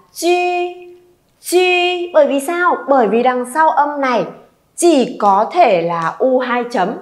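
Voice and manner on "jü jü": "chi" with rounded-lip ee, held level and higher; correct ü vowel. Shown as a demonstration. Teacher corrects the misreading, "chi" with a long ü vowel. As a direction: sustain "chi" on a high level ü, unaspirated. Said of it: "Âm này chúng ta sẽ đọc là".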